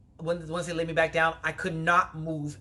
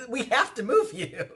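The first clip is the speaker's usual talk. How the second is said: higher, laughing imitation of the medic; laughing mock EMT; 9 semitones higher than usual. Mimicking the EMTs insisting to her, laughing mock voice. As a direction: quote them through a laugh.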